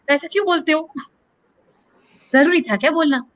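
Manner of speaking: mock scolding. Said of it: "ऐसे डांट दिया मेरे को".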